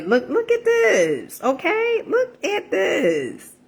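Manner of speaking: high, slow, drawn-out thrilled squeal; giddy high showing-off voice; excited squeal. Real, not acted. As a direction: squeal it like you can't believe how pretty it is. Shows off her colorful plate.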